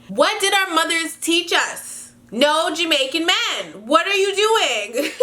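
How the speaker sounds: high-pitched, drawn-out telling-off; exasperated scolding. Real, not acted. Scolding the letter writer for dating him.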